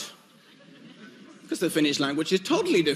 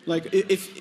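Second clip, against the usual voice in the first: normal talking voice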